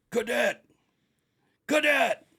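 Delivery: high, loud barked shout of the word; drill instructor yell. Retold or imitated. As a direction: bark it like a drill sergeant. Drill instructor yelling at recruit.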